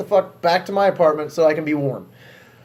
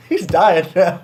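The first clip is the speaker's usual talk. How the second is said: slow words through laughter; laughing while talking; 3 semitones higher, 4 dB louder, slower than usual. Real, not acted. Laughing at his own story.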